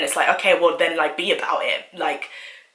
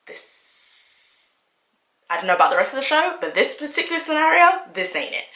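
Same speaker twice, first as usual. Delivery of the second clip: feminist voice; 8 semitones higher than usual. Retold or imitated. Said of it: "the feminist in me was just like".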